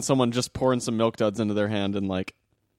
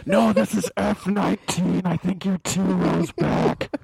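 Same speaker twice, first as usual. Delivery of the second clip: high mock hush, slowing at the end; hammed-up stage whisper; 8 semitones higher, slower than usual. Seated moviegoer correcting the other, hammed-up high hush. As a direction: play the put-upon seat neighbor. Answering in the seat skit.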